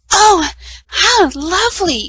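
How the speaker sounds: high, slow and thrilled; delighted cry. A narrator's reading. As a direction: gasp of joy at the dress. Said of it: "she cried".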